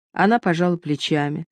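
usual speaking voice

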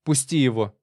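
firm wife's command; tense, insistent order; desperate demand. A narrator's reading. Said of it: "с отчаянной решимостью шагнула к нему и потребовала".

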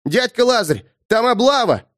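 put-on high thin boyish call; thin boy's voice. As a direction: call out like a small boy. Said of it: "крикнул хозяину лавки тоненьким голоском".

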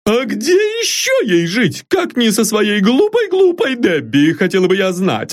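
very high, doting servant cooing fondly; an old servant fussing over her mistress; high, fond, bustling maid voice; fond exclaim from old maid. A narrator's reading.

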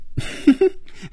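high, breathy giggle; burst of laughter. A narrator's reading. Phoebe cracking up at Hongjun, high sudden laugh. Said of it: "菲比一下子笑了起来".

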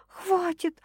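high whimpered protest; whimpering girl. A narrator's reading. Girl begging him to stop, high whimper. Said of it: "хныкнула девушка".

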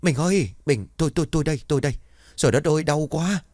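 high, stammering cry of pain. Acted. Husband under the carrying pole begs his wife, high pained cries. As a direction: whimper it high as the blows land. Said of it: "tiếng kêu yếu ớt đau đớn".

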